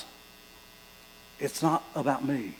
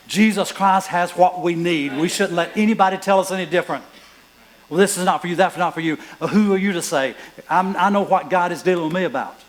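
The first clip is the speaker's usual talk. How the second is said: loud, raised exhortation; preaching; 4 semitones higher, 6 dB louder than usual. Acted. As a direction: urge the church not to listen to doubters. Preaching at full force.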